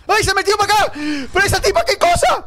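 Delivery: high frightened yelp; panicked shriek. Real, not acted. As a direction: shriek as the nun closes in. Nun follows him into room.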